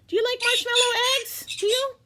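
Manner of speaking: high, quick sing-song to a pet. Real, not acted. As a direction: coo the question at the bird. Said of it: "asking the bird playfully".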